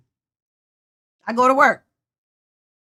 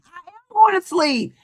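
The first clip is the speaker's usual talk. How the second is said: laughing; 9 semitones higher than usual. Real, not acted. Laughing while signing off.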